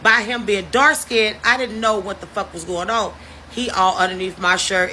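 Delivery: ordinary talk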